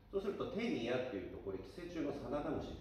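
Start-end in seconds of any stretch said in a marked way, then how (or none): none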